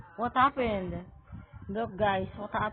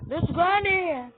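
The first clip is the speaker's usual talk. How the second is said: scared cry; 10 semitones higher, 8 dB louder than usual. Real, not acted. Spotting Granny, scared.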